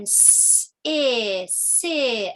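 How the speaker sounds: s hissed, then I, then si; phonics sounding out. Shown as a demonstration. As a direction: sound out si for children. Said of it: "as in the word city".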